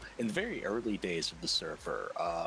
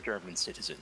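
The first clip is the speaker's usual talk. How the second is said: normal talking voice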